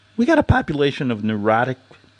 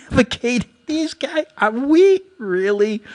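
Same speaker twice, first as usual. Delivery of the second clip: laughing, high and incredulous; mocking laugh; 12 semitones higher than usual. Real, not acted. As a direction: scoff at the ad in disbelief.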